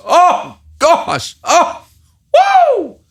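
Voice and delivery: high, loud whooping disbelief; stunned exclamation. Real, not acted. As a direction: yelp at a blowout score. Reacting to Lions' blowout loss.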